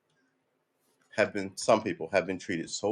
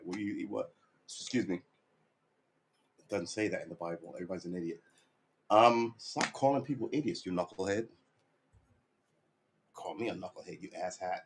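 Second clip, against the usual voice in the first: muttered self-argument; 3 semitones lower, 6 dB quieter than usual. Pretend. Mock argument with himself.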